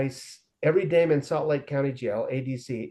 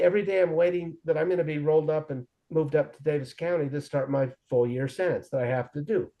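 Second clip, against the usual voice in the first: ordinary talk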